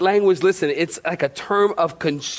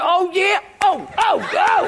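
mock challenging mom; 11 semitones higher than usual. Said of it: "She said".

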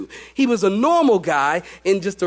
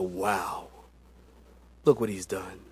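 much lower, softer awed wow; hushed awe; 11 semitones lower, 8 dB quieter, slower than usual. Retold or imitated. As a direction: breathe it out in wonder. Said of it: "to look at you and go".

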